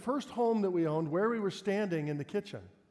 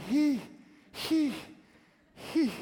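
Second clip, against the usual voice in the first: sputtering he; 8 semitones higher, much slower than usual. Retold or imitated. Boy glaring at his dad, high huffing bursts. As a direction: huff the word in short bursts. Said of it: "he was just looking at me".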